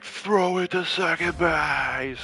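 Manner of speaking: high, drawn-out field call; coach's yell. Shown as a demonstration. Softball coach to nine-year-old players, drawn-out yell. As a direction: holler it slowly across the diamond. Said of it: "So I'm literally like".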